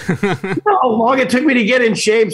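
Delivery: mock indignant rant. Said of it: "And I'm like".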